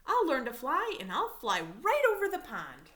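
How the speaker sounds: loud, resolute declaration; determined vow. Acted. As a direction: proclaim it like a promise to yourself. Determined frog vowing.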